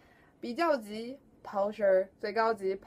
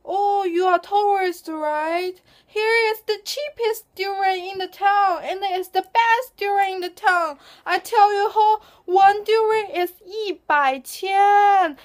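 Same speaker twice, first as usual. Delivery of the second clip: durian uncle voice; 10 semitones higher, 8 dB louder than usual. Acted. Durian uncle overcharging tourist.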